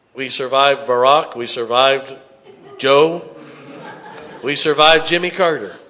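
loud, punchy pulpit cadence; rally the congregation with each name; building sermon cry; rousing preaching. Acted.

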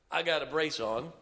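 ordinary talk, nothing marked

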